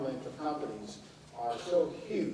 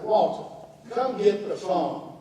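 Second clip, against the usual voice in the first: high, raised, slow call; retold aunt calling Walter once more; 5 semitones higher, 7 dB louder, slower than usual. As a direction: drag out the summons to the phone. Aunt calls one more time.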